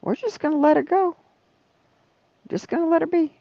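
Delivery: high, far-off imitation of the calm doctor; voicing the doctor in a lifted mock tone; quoting the doctor. Doctor reassuring her, imitated high and easy. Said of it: "He says".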